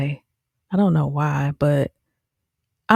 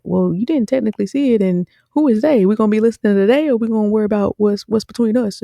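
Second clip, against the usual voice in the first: higher, rushed dismissive deflection; mock evasive gaslighting; 6 semitones higher, 3 dB louder, faster than usual. Boyfriend dodging his girlfriend's accusation, mock evasive. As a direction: brush her off with slick semantics. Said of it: "play all these semantics like".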